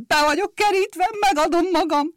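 high, loud panicked shout; frightened cry. Acted. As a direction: driver yells in fright at the whistle. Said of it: "rémülten felkiáltott". (rémült felkiáltás)

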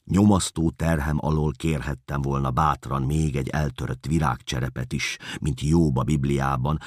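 ordinary delivery

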